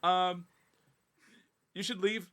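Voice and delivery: high, nervous, half laughing; nervous laughing dismissal. Retold or imitated. She tells the stranger to leave, nervously laughing. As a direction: politely shoo her out while rattled. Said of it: "I'm like".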